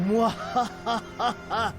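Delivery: drawn-out evil mwahaha; slow theatrical villain cackle; slow villain laugh. Pretend. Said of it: "it's still not quite right".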